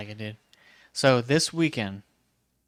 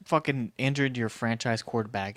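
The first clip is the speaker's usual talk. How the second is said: ordinary delivery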